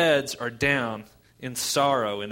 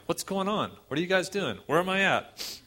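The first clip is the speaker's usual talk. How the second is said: high-pitched, mock-confused questions; bewildered; 7 semitones higher than usual. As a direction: play someone waking up utterly disoriented. Revived dead man waking up confused.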